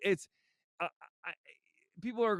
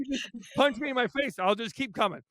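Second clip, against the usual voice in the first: laughing; 7 semitones higher, 3 dB louder than usual. Real, not acted. Joking bar fight bravado.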